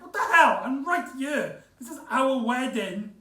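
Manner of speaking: high, loud, indignant "What the hell?"; indignant outburst. Retold or imitated. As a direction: protest as the jilted groom right beside her. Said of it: "Rory's like".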